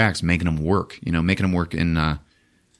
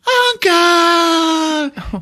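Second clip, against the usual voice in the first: high, long anguished wail; boy losing his uncle; anguished cry; 5 dB louder than usual. Retold or imitated. Boy crying for dying uncle.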